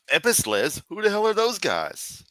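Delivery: high, puzzled "Epistles?" then a high mock-dumb question; mock bafflement. Pretending not to know the Bible book name. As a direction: play dumb about the word. Mock confusion over the word.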